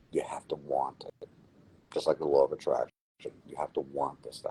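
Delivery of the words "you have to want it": whisper. Real, not acted. Whispered advice.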